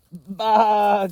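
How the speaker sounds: high, loud bleat; sheep cry. Acted. Sheep bleats as racers pass, high and loud. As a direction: call out like an excited sheep. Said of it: "cried Sheep".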